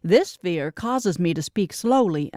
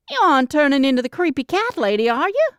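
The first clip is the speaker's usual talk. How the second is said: 1 teasing younger sister; 7 semitones higher than usual. Acted. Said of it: "Carrie, my younger sister, asked".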